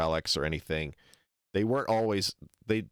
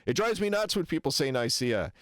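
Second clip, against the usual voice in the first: higher-pitched, imitating a pedant; retold adamant declaration, pitched up; mock insistence; 6 semitones higher than usual. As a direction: lay down the rule in mock earnest. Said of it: "To the point where he says".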